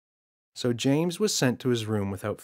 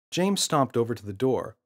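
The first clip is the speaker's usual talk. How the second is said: ordinary delivery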